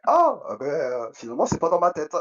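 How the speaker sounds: high, loud startled cry of relief; a sudden loud, high shout of discovery; surprised outburst. Retold or imitated. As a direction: blurt it out, suddenly realising it is real. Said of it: "je fais".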